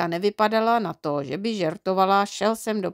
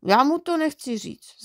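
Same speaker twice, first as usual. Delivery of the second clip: higher-pitched mild whimper; whiny boy; 7 semitones higher than usual. A narrator's reading. Boy dreading confessing to dad, mildly whiny. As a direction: whine like a reluctant child. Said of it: "zafňukal jsem a začal jsem znovu nabírat".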